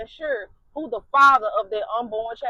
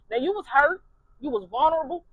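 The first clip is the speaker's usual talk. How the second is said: ordinary delivery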